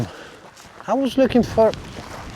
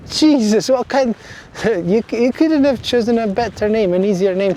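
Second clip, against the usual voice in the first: laughing exasperation; 6 semitones higher than usual. Real, not acted.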